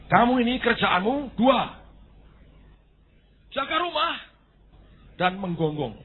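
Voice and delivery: high, loud commanding voice; voice of God. Acted. God tells the dog its duties, high and commanding. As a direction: lay down the law from on high.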